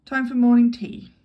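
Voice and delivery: loud, brisk announcement; brisk call. Acted. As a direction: herd the group along. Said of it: "Anthony said briskly".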